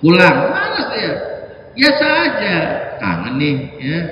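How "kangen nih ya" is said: lower, softer, quick casual invite; casual warm father. Shown as a demonstration. Father casually inviting his son out, soft and relaxed. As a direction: say it light and easy. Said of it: "Harus begitu".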